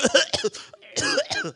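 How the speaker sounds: high, choking splutter; acted coughing. Parasailer catching breath in salt water, acted coughing. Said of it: "I'm coughing".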